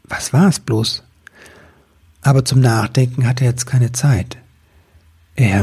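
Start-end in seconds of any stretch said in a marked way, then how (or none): none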